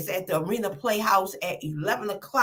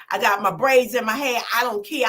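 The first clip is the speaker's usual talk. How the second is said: ordinary talk, nothing marked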